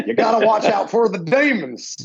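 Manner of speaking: high, hammed-up Southern warning; mock Southern accent. Joking after Danny McBride, high mock Southern accent. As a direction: cry a warning in cartoonish Southern. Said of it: "that in a Southern accent".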